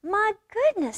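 high-pitched storybook cub; scolding little sister. A narrator's reading. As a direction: exclaim primly in a high child's voice. Cub mock-scolds noisy parents.

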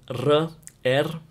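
R with a trilled tongue; rolled R. Shown as a demonstration. Teacher shows the Russian letter, rolled R. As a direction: roll the R, as in run but trilled. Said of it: "as in a run but rolled".

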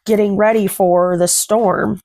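plain reading voice

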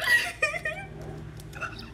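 very high, thin laughing squeal; helpless high-pitched giggle trailing away; giddy squeal. Real, not acted. Fan overwhelmed by her pull, long giddy squeal.

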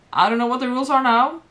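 usual speaking voice

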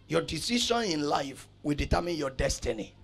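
usual speaking voice

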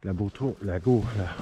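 ordinary talk

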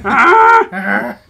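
put-on loud, high old lady sigh; hammed-up old woman groan. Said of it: "It's our old woman voice".

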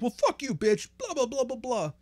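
high-pitched mock outburst of a rejected loser; mock a sore loser in a high voice; whiny loser. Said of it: "the losers would be the ones like".

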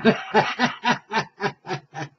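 a soft laugh fading out; trailing laugh. Real, not acted. Host laughing at his own jingle, fading laugh. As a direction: let the laugh die away.

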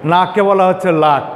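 ordinary talk, nothing marked

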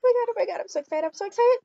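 high, fast giddy chant; excited chant, then calming; excited squeal. Real, not acted.

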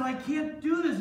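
high-pitched protest; alarmed refusal. Acted. Joseph turns down his boss's wife, alarmed.

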